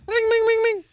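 high sung-out ring, ring; phone ring imitation. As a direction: squeak like a ringing phone.

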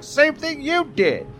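high, slow comeback; mock protesting. Answering his coworker's accusation, mock protesting.

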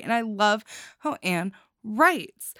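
ordinary talk, nothing marked

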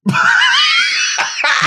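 high shrieking burst of laughter; loud shrieking laugh. Real, not acted. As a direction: let out a wild squealing laugh.

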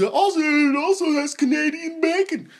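high-pitched, naive offering; mock your own foolish eagerness; dumb eager self. Telling dad about the toppings, high mock-naive voice. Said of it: "my dumb ass is".

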